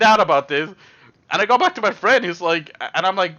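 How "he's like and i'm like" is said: ordinary talk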